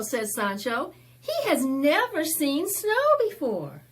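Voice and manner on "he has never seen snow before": put-on quick, high and loud kid voice; high child's voice. Sancho introducing his cousin to the neighbor kids, high and bright. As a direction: announce it proudly like a little boy.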